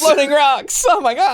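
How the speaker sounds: high, loud fake terror; mock panic. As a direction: shriek it in pretend dread. Echoing the mock horror.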